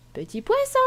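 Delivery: high sing-out call; playful calling out. Pretend. Player calls the hidden little fish, playful high call. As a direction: call sweetly for a lost pet, pitched right up.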